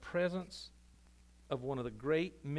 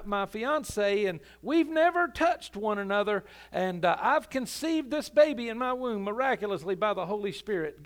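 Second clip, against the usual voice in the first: loud, higher preaching voice acting the doubter; skeptic's challenge; 5 semitones higher, 7 dB louder than usual. Skeptic daring the pastor, voice raised and higher. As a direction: push the pastor into a corner.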